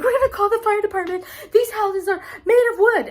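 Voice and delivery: high, fast mock panic; panicked neighbor. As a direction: squeal it out like a frantic neighbor. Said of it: "freaked out at me".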